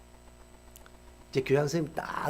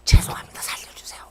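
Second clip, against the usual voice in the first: put-on begging kids; 7 dB louder than usual. Kids beg shop owner.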